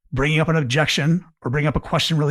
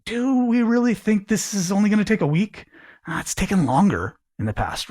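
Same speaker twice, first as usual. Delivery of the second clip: higher, open, wondering question; curious; 6 semitones higher than usual. Shown as a demonstration. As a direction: sound like you really want to know.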